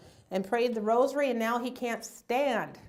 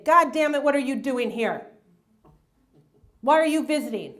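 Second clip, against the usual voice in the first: mock cranky outburst, loud at the start; sudden irritation; 2 semitones higher, 6 dB louder than usual. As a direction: lash out briefly at an unwelcome visitor. Acting mom's sudden hostile shift.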